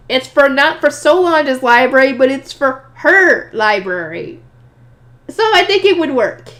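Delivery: loud protest, climbing high at the end; insistent protest. Acted. Defending the circle for her library, insistent protest. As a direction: argue back, stretching out the key word.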